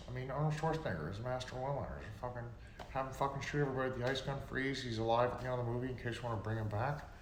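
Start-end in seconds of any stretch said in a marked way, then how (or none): none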